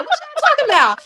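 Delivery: high, baffled "what are you on about"; confused reply to the kids; puzzled retort. Retold or imitated. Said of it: "And then I'm like".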